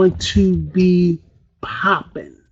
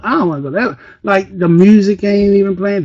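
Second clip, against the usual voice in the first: normal talking voice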